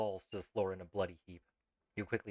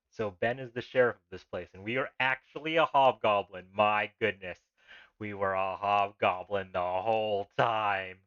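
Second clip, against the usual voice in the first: loud amused shock; 11 dB louder, slower than usual. Real, not acted. Shocked discovery of being a hobgoblin.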